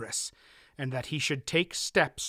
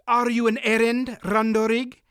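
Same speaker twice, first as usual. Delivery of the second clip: put-on louder, pressing accented question; 8 semitones higher, 6 dB louder than usual. A narrator's reading. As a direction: push him hard for an answer. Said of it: "the Murgo pressed".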